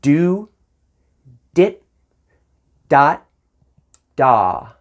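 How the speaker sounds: each syllable its own horn attack, separated by pauses; jazz articulation syllables. Shown as a demonstration. Teacher demonstrating sax articulations to students, loud and crisp. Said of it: "I'll say them once again".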